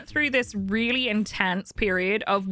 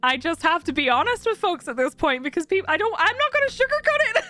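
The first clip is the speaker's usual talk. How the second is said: high, giggly laughing delivery; laughing through it. Real, not acted. Telling chat about granddad, laughing nervously.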